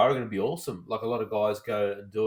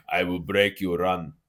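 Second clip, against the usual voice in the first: slow, low mock Russian accent; hammy Ivan Drago drawl; 4 semitones lower, 3 dB louder, slower than usual. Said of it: "Fuck, terrible".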